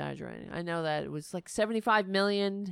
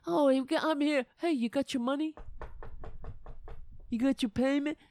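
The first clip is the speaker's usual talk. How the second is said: mobster collector voice; 4 semitones higher, faster than usual. Retold or imitated. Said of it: "They allow it to continue".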